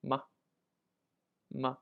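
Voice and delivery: "ma" short and light with no tone; neutral tone ma. Shown as a demonstration. Teacher modeling the question particle, light neutral tone. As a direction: toss off "ma" lightly. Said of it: "fifth neutral tone".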